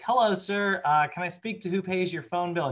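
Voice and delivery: polite telemarketer. Retold or imitated. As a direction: play the sweet caller on the line. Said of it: "this poor woman calls, and she's like".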